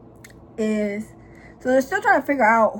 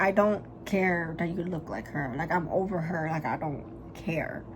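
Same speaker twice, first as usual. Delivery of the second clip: low, slow, soft indifference; slow, quiet brush-off, retold; dismissive; 4 semitones lower, 7 dB quieter than usual. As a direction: voice him low and unbothered.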